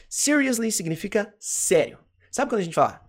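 usual speaking voice

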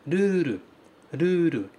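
"ruru," with a tapped R and a long final u; Japanese ruru. Shown as a demonstration. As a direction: model "ruru," with flap Rs and a long u. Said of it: "É o ra do arara". ("ruru" com R batido e u longo)

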